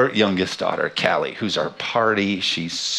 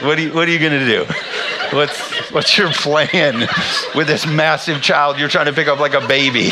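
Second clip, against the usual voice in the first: louder, higher, half-laughing question; laughing teasing; 5 semitones higher, 3 dB louder than usual. Retold or imitated. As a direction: tease her through the giggles. Dad teasing mom, laughing.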